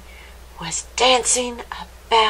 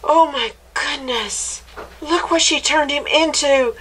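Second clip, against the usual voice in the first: higher-pitched amazed exclamation; astonished; 5 semitones higher than usual. Acted. Reader to listener about what she turned him into, astonished. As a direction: marvel at the finished creature. Reader reacts to final result.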